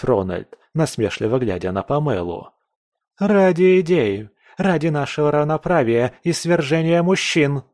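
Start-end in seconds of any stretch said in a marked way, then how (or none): 3.2-7.6 zealous Pamela. A narrator's reading. Pamela proclaims her cause, higher and fervent. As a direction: preach the cause.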